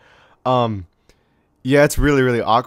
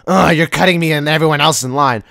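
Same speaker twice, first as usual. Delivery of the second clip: loud, put-on annoyed complaint; groan, then complain loudly in a put-on voice; annoyed lady; 7 dB louder than usual. Lady telling off the kid who cut the line, annoyed. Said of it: "the lady was like".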